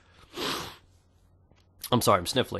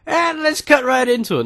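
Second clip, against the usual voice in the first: hype announcer; 6 dB louder than usual. Acted. Hyped intro to the story.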